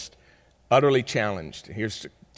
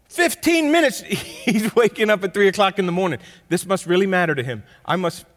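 lively preaching; 9 semitones higher, 8 dB louder than usual. Acted. Laughing, fired-up preaching.

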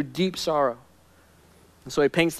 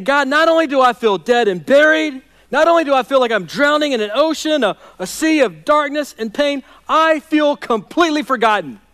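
preaching a despairing prayer, higher and louder; anguished prayer; 7 semitones higher, 4 dB louder than usual. Acted. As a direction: cry out to God as a man who feels dead. Voices the forgotten psalmist.

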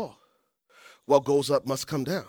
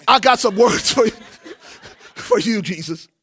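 Martha retake, louder, breaking into a laugh; Martha line with a laugh; 4 semitones higher, 4 dB louder than usual. Acted. Preacher redoes Martha's warning to Jesus, louder and laughing. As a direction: redo it louder and laugh through it. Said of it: "Okay, you're not Jesus".

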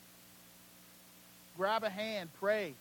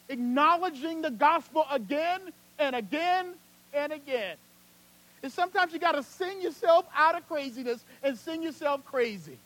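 raised, loud sermon voice; impassioned preaching; 8 semitones higher, 9 dB louder, slower than usual. Real, not acted. Preacher urging the congregation to sing through craziness, impassioned. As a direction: lift it up and drive it home.

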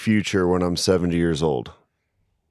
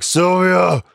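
put-on grumpy old man; 2 semitones higher, slower than usual. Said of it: "he was like".

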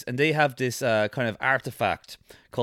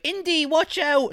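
much higher, slow warning cry; sidekick cry; 14 semitones higher than usual. Retold or imitated. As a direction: squeak a panicked warning. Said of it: "his little comp, like".

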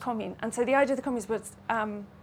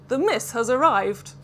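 a louder call of the announcement; mild bellow; 7 dB louder than usual. Acted. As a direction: announce the arrival loudly. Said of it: "he bellowed".

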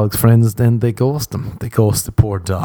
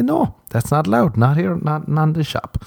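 high-pitched scold; 7 semitones higher than usual. Retold or imitated. Scolding stranger acted in bit.

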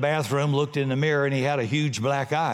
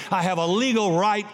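normal talking voice

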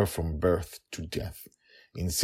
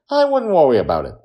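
put-on high, loud and fast child; brushing it off as a small girl; Joy shrugs it off, high girl voice; carefree little girl; 10 semitones higher, 10 dB louder, faster than usual. A narrator's reading.

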